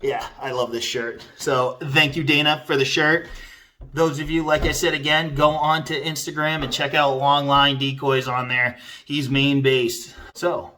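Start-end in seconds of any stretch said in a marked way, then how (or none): none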